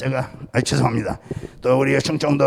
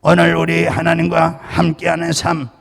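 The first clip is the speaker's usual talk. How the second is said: firm, ringing pulpit delivery with cadenced endings; preaching; 2 semitones higher, 5 dB louder than usual. Acted. Pastor preaching on hearing God to his congregation.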